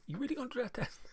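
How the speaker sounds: high mock-teasing voice; teasing Skoda text. Skoda nagging the host, mock teasing voice. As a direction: read it like a cheeky nudge. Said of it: "text messages from Skoda going".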